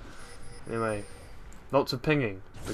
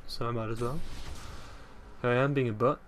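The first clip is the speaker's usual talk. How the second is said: ordinary talk, nothing marked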